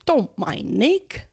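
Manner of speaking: slow booming huntsman; hearty shout. Acted. Patrick's head to the guests, booming. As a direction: call up from the floor. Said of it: "shouted Sir Patrick's head".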